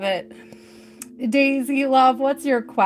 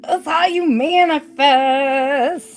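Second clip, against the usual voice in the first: slow, higher, louder mock pronouncement; grand proclamation; 5 semitones higher, 6 dB louder, slower than usual. Shown as a demonstration. Tarot reader to viewers, a hammed-up grand declaration.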